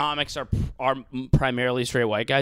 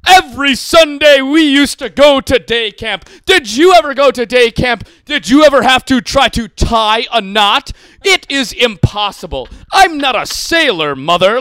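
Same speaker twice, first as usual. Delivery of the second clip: put-on loud, high, drawn-out old-time showman voice; John Mulaney; 9 semitones higher, 13 dB louder than usual.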